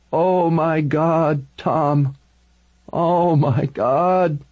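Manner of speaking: high, slow wail of horror; anguished cry. A narrator's reading. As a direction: draw it out high, breaking down in anguish. Vinny collapses in horror, then cries.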